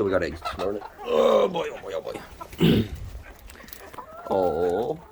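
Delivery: ordinary delivery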